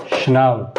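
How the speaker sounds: schnell said as a clipped English "shunnel"; English ending. Shown as a demonstration. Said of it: "English में क्या होगा?". (schnell अंग्रेज़ी अंदाज़ में)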